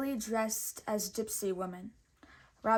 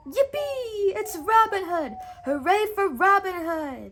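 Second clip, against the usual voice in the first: much higher, louder joyful cheering; cry of joy; 10 semitones higher, 9 dB louder than usual. Acted. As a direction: cheer the hero with delight. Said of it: "let out a cry of joy".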